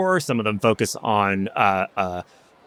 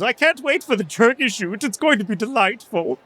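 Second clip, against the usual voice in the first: lightly voiced gleeful clown, much higher; 11 semitones higher than usual. Clown gloats about the coming turkey shoot, high and giddy. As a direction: pitch it up like a giddy clown waiting for the hunt. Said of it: "He goes".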